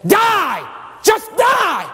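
high, punched shout; commanding devil. Acted. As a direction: hurl the order as the tempter.

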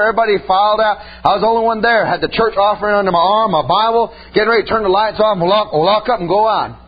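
ordinary talk, nothing marked

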